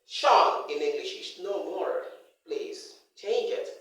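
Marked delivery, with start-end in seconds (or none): 0.1-0.7 tion said as SHON with a full o; full-vowel "Sean" for the tion ending; wrong "shon" ending. Shown as a demonstration. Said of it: "Don't pronounce a word and say it".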